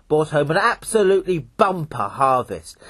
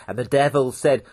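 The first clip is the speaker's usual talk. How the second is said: plain reading voice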